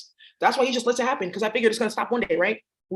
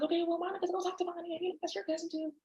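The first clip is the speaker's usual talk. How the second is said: much higher, much softer mother's voice; mom voice; 8 semitones higher, 13 dB quieter than usual. Retold or imitated. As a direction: coo like a gentle mom. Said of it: "that's where Mom talks".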